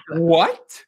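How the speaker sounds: mildly exaggerated startled question; [name]'s shocked reaction. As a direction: react with a rising, disbelieving question. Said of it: "[name]'s reactions are always so funny".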